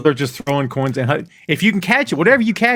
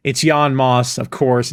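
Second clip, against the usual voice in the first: normal talking voice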